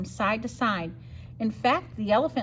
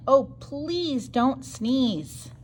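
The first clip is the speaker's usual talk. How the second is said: pleading plea; 3 semitones higher, much slower than usual. Acted. Bees pleading refrain.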